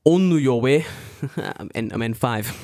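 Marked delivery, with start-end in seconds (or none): 0.0-0.8 put-on loud Japanese-accented English; syllable-by-syllable Japanese accent. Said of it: "as the Japanese would say".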